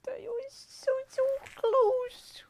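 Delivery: high whimpering fake sob; mock crying. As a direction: sob it out, hammed-up. Losing the game, wailing.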